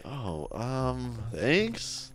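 soft, hesitant acted thanks; awkward thanks. Patient answering the doctor's ear compliment, awkward thanks. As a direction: trail into a small, uneasy thank-you.

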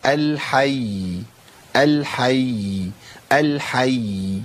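الحي repeated very slowly, each ya held out; lengthened final ya. Shown as a demonstration. Teacher demonstrating the doubling for students, long-held ya in الحي. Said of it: "أزود زمن شوية بس عشان أبين".

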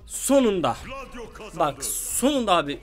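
relieved cry. Real, not acted. Finally kills the enemy.

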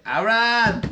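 put-on high, loud, slurred-sounding drunk call; drunk demanding entry. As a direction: bellow at the door like a drunk. Drunk at the door.

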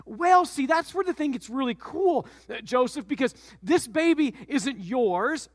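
put-on high, excited young wife; eager Mary. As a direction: bubble over with the good news.

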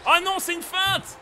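high, slow despairing wail; anguished squeal. Real, not acted. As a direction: cry out as the fake fools you. Anguish at a missed fake.

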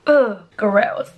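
ordinary talk, nothing marked

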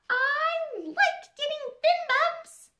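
high, slow, pleased little fish; happy pufferfish voice. A narrator's reading. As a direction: beam it out like a calm fish. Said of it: "said the fish".

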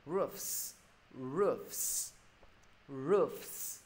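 ROOFS with f and s both sounded; clear enunciation. Shown as a demonstration. Teacher modelling for students, ROOFS with clear f-s ending. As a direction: stretch the final fs of ROOFS. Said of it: "Cho mọi người là phải đọc rõ".

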